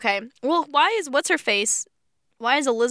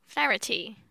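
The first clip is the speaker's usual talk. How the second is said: put-on Irish accent try; 6 dB quieter than usual. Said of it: "Is that how you say it in the accent?".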